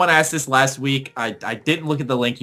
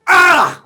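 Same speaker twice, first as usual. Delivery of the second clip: high loud mock grunt; 9 dB louder than usual. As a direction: belt out a serve grunt. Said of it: "I gotta make this loud sound".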